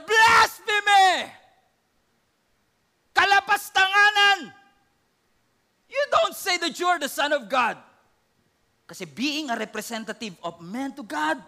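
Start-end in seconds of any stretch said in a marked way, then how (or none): 0.0-7.8 high, loud outraged shout; the high priest shouting blasphemy at Jesus; furious accusation. Acted. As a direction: roar the charge.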